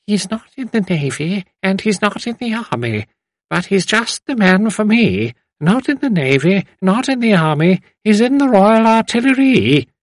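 put-on very old lady piping up; high, thin, singsong little voice. A narrator's reading. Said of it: "piped up in a thin voice".

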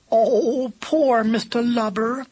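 high, slow, drawn-out coo. A narrator's reading. Pigeon pitying Lubber's bloody nose, cooing. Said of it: "cooed Katy".

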